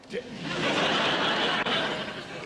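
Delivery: breathy nervous laugh, brushing it off. Acted. Husband laughing off his wife's accusation, nervous chuckle. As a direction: laugh it off as if it's all silly.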